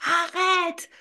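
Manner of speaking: high sharp yell; child shouting at the mother to stop. Retold or imitated. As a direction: cut her off with a shout. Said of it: "Je criais".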